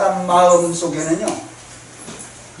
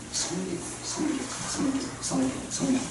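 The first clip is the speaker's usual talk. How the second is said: much softer, near whispered repetition; fading whisper; 3 semitones higher, 15 dB quieter than usual. Acted. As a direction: let the word fade to a whisper. Repeated word fading to whisper. (속삭이듯 점점 작게)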